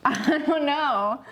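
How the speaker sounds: high, slow laughing admission. Real, not acted. Host laughing at her own confusion, laughing. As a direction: giggle the words out slowly.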